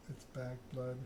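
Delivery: ordinary delivery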